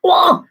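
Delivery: sudden loud, high "whoa"; mock ASMR sound effect, high and loud; imitated ASMR noise. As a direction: pop out an exaggerated mouth sound. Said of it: "they make sounds like".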